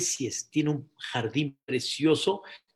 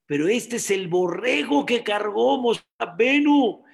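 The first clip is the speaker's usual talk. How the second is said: high, raised preaching voice; fervent proclamation; 6 semitones higher, 4 dB louder than usual. Real, not acted. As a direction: build to a loud, ringing climax. Preacher proclaims his realization.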